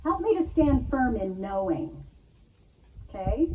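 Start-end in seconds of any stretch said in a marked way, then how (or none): 0.0-2.1 prayer. Acted. Reads out a prayer.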